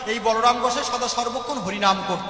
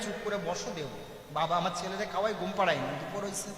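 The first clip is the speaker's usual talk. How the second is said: much softer, low hushed voice; hushed aside; 6 semitones lower, 11 dB quieter than usual. Acted. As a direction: keep it down so the child sleeps. Mother hushes the beggar. (ফিসফিসিয়ে ভিক্ষুককে থামানো মা)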